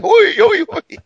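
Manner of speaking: high, loud repeated Oi calls; shouting vendor. Retold or imitated. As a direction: bellow for attention in the market. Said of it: "they're shouting".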